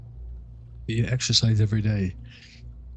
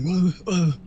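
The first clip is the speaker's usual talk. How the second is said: high, startled yelp of alarm; imitating someone jumping in panic; freaking out; 9 semitones higher, 3 dB louder than usual. Streamer acting out his own startled panic, high and loud. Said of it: "freaking out, like".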